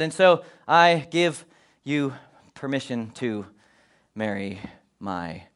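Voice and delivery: slow solemn father's blessing, each word weighed. Retold or imitated. A father finally granting permission, very slow and low. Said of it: "It was very slow".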